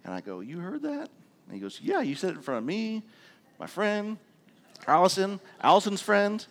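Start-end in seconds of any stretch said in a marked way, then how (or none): 0.4-1.1 high, rising startled question; caught-out surprise. Retold or imitated. Father learns his son overheard him, caught-out surprise. 1.8-6.5 lightly voiced child, pitched up; a hint of an indignant kid, growing louder; eight-year-old son. Son telling dad who overheard him, lightly voiced child.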